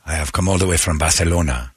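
low, mock Spanish with lisped Barcelona; lisping Spanish accent. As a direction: grandly lisp as a Spanish traveler. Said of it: "the Spanish because they encourage you to lisp".

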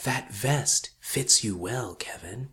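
slow, hushed forest whisper. Retold or imitated. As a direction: whisper it slowly, like trees to a passer-by. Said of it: "It whispered".

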